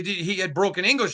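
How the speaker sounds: usual speaking voice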